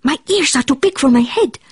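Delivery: put-on Spanish accent. A narrator's reading. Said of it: "using his very best Spanish accent".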